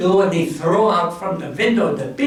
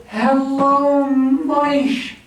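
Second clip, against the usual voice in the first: Jack's greeting; 7 semitones higher, much slower than usual. Acted. Jack greets the giant.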